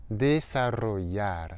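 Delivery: desarrollar slowly, rolled rr and ll as y; slow model word. Shown as a demonstration. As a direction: stretch out desarrollar syllable by syllable. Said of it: "ça se prononce comme un Y".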